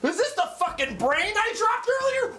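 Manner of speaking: retelling a shocked reaction in a squeaky high voice; incredulous freakout. Retold or imitated. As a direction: voice flying up in stunned panic. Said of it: "I'm like".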